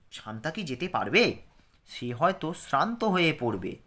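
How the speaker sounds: clear, careful lesson reading for pupils; reading aloud. Acted. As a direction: textbook reading voice. Reads the dialogue text.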